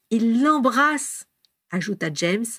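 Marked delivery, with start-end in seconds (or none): 0.1-1.2 "Il l'embrasse" higher and very drawn out; emphatic spelling-out. A narrator's reading. James making himself clear, "Il l'embrasse" stretched emphatically. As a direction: stretch "Il l'embrasse" slowly so it's perfectly clear. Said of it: "peur de ne pas avoir été assez clair".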